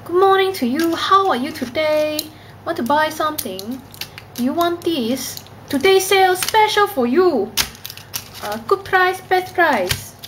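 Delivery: high-pitched singsong Cantonese accent. Shown as a demonstration. Seller pushing today's special on a customer, Cantonese accent. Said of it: "this is how I think they speak".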